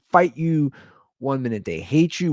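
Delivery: ordinary talk, nothing marked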